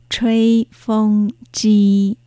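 吹風機 very slow, every syllable lengthened; model pronunciation. Shown as a demonstration. Teacher repeating the word for hair dryer, slow model pronunciation. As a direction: pronounce 吹風機 slowly for learners to copy. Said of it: "風の発音は".

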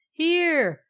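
high, loud answering call. A narrator's reading. Poker answering from behind a cloud, calling out. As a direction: sing out from a distance. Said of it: "called the poker".

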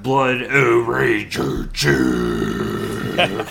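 drawn-out, loud hammed-up roar of a name; hammed-up name announcement. Host introducing the next emailer's name, hammed-up drawn-out roar. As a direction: announce it like a monster, stretching each word.

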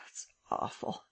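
breathless whisper of despair. A narrator's reading. Lupin near tears, whispering in despair. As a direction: whisper it hopelessly.

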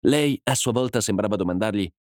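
usual speaking voice

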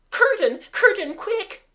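high, loud frightened cry; a girl shrieking for the curtain in fright; panicked cry. A narrator's reading. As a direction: shriek it in sudden terror. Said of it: "in a voice that wasn't Mabel's or the Beauty's".

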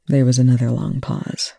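usual speaking voice

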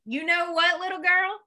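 put-on high-pitched warning to a child; mock scolding. Imagining dad losing his temper at daughter, high mock scolding. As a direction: wind up for a pretend telling-off.

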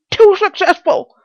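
very high, loud lament; distressed Pycraft. Acted. Pycraft explaining his plight, high and loud. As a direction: wail it as the stranded character.

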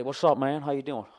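a hint of an impersonator working the room. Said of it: "doing the [name] thing".

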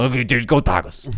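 lightly voiced Cajun coach; mock Cajun football cheer; Ed Orgeron. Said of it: "say it like Ed Orgeron".

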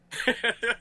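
sudden bright burst of laughter; laughing. Real, not acted. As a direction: laugh out loud, caught off guard. Laughs at own confusion.

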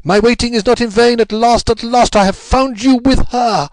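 high, loud frantic scream of a jealous woman. A narrator's reading. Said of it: "a woman's voice which screamed in French".

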